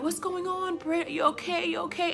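high, fussing mock concern; concerned classmates. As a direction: imitate friends crowding round with worry. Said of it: "everybody was concerned".

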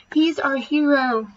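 drawn-out cheer for the hero; slow adoring cheer. A narrator's reading. Teachers hail the janitor slowly, high and loud.

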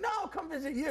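high-pitched mock insistence; insistent friend. Friend dodging a visit to Buffalo, mock insistent. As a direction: act out a pleading refusal, voice way up.

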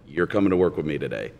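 normal talking voice

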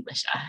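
"Lisha" as LISH-a; unwanted mispronunciation. Retold or imitated. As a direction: say "Lisha" with a short i, not ee. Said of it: "stop calling me".

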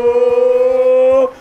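loud drawn-out whoa; hammy stretched wonder; mock amazement. Reacting to the pun, hammed-up whoa. Exaggerated exclamation.

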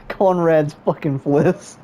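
laughing through the words; giggling as he blurts it out. Real, not acted. Reveals gossip, laughing.

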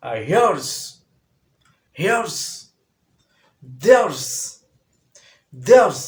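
loud "here's", "there's" ending in z; drilling the contractions loudly. Shown as a demonstration. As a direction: punch "here's" and "there's", finish on z. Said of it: "এর উচ্চারণটা জ".

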